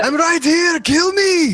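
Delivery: high-pitched, hammed-up wail of protest; mock indignant protest. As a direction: cry out as if comically forgotten. Jokey protest at being skipped.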